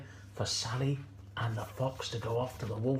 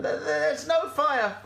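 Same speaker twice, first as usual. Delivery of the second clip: high stammering goat voice; Gladys the goat; 13 semitones higher, 4 dB louder than usual. Acted. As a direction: correct her with a bleating stutter. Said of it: "said Gladys".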